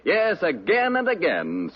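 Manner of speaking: ordinary delivery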